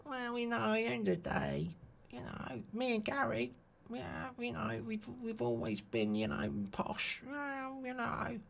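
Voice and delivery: very soft, high footballer impression; David Beckham. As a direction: murmur haltingly in a small, high London voice. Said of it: "David Beckham".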